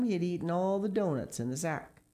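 usual speaking voice

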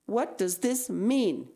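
loud, high, slow stern demand; a teacher scolding slowly and hard; teacher confronting a pupil in class; angry. A narrator's reading. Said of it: "he said angrily".

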